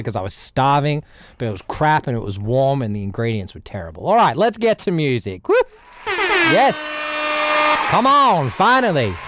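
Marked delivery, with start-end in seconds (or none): 5.5-9.1 much higher, excited cheer; relieved cheer. Real, not acted. Streamer cheering as his music starts, excited. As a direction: celebrate the music finally working.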